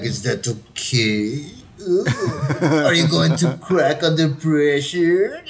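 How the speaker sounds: slow, daring taunt; mock threatening taunt. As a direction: drag it out like a dare. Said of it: "looks at me kinda, like, threatening".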